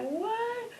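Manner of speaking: high-pitched astonished what; shocked what. Retold or imitated. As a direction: gasp it out in disbelief. Mother stunned by pregnancy news.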